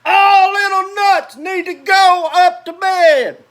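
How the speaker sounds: very high, very loud stern shout; mother at her limit, shouting bedtime; biggest mama voice. A narrator's reading. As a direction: bellow it like a furious mom. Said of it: "in her biggest voice".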